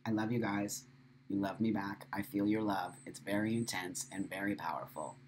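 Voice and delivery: soft and low, heartfelt; tender. Real, not acted. Telling viewers she loves them, tender and soft. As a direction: hold them close with your voice.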